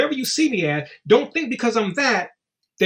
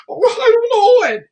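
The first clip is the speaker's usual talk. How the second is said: mock clueless answer; 6 dB louder than usual. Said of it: "they start laughing like that shit is cute".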